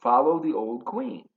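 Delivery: ordinary delivery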